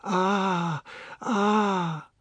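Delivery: high, drawn-out "ah"s; wordless sustained moans; series of "ah"s. A narrator's reading. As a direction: moan long high "ah"s while being massaged. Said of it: "reducing Missus Tulsi's speech to a series".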